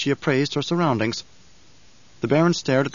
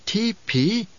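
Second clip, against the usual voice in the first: ordinary delivery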